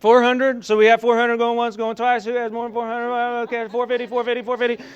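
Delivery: fast, high, loud auctioneer chant. Pretend. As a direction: rattle off bids like an auctioneer. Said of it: "That's my auctioneer voice".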